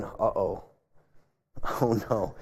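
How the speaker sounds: a hint of a caught-out tax man going uh-oh; mock nervous Zacchaeus. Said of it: "imagine Zacchaeus going".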